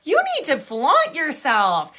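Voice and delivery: sassy encouragement. Retold or imitated. Stranger bossily encourages the speaker.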